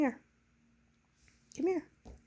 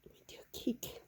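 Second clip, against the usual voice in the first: whispering; 9 dB quieter than usual. Real, not acted. Whispering to hiding pet.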